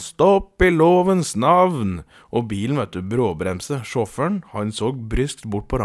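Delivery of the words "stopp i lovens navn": loud, high, slow commanding shout; skrik. A narrator's reading. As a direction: call it out long and loud, halting someone in the name of the law. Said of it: "skrek han". (høyt, langtrukkent skrik)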